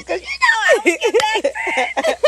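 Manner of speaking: cracking up while talking; laughing. Real, not acted. Cohost laughing at her friend's reaction, loud.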